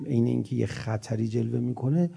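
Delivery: ordinary talk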